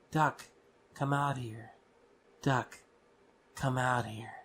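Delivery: lightly voiced eerie summons; imitated parent's voice calling. Retelling voices heard in a dark room, imitated calling. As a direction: repeat it as a measured call, pausing after the name. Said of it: "it was my parents' voice saying it".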